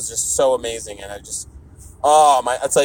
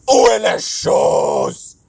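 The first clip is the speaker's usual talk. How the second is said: slow, loud crying-out French; wailing French; 2 semitones higher, 3 dB louder, much slower than usual. Pretend. Speaker wailing a French phrase, mock tragic. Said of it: "Where's the stuff?".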